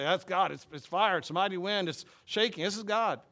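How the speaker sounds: mock people sure they found God; excited exclamation. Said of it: "Things that we would say".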